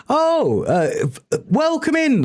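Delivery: high bright welcome; excited greeting. Real, not acted. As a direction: greet them with a big high lift. Greeting a new chatter.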